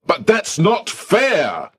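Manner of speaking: high, indignant cry of unfairness; outraged newcomer shouting at the clerk; shouted protest. Acted. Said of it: "I shouted".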